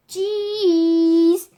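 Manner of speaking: loud, high, sing-song cheese; posed "cheese". A narrator's reading. Posing for Jeremy's photo, loud sing-song. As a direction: belt out a long drawn cheese.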